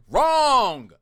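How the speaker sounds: one loud, high word; loud verdict. Real, not acted. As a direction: call it out like a buzzer. Reacting to a badly worded post.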